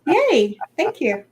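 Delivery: normal talking voice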